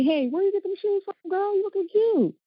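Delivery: put-on high-pitched girlfriend gushing; gushing compliment. Woman complimenting another woman's shoes, gushing. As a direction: pitch it way up, like hailing a friend.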